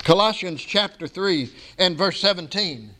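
usual speaking voice

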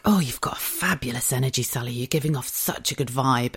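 softly whispered mystic compliment; psychic whisper. Retold or imitated. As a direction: breathe it like a soothing psychic. Psychic voiced, retold.

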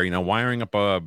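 usual speaking voice

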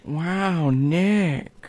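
lightly done celebrity impression; Owen Wilson. Co-host joining in on the Owen Wilson impression. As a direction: stretch the wow in a light impression. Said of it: "he really turns into Owen Wilson".

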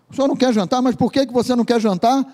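high, surprised questioning voice; astonished steward. Acted. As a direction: act baffled that he turns down dinner. Comissário espantado com a recusa. (comissário espantado, voz aguda)

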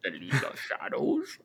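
low, soft Bane growl attempt; lightly voiced Bane. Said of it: "my Bane one".

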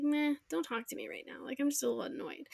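understated mock irritation; mild annoyance. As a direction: brush them off, acting a little irked. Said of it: "I'm, like, annoyed".

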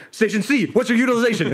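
high, projected station call; mock captain demanding a report; ship's captain. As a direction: call out to the crew. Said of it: "felt like we were on a ship".

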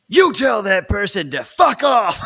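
loud, high, hammed-up coach voice; mock shouted order. Coach telling him to dismiss the critic, loud mock shout. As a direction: bark it like a fired-up coach. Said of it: "He goes".

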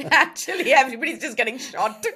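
loud, laughing through the words. Real, not acted. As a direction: laugh it out, amused. Laughing at the film quote.

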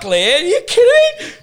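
high, loud, slow squeal of laughing disbelief; retold shock, laughing high and loud. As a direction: crack up in shock. Retelling his shocked reaction.

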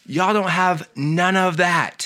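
exasperated scoff. Real, not acted. Ranting about country episode.

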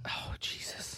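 voiceless, very soft aside; dismayed whisper. Real, not acted. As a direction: whisper it under your breath in dismay. Dismay at hearing the right answer.

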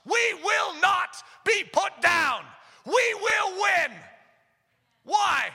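slow, loud, high rallying cry; rally speech. Acted. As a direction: proclaim victory to the crowd. Rallying the congregation.

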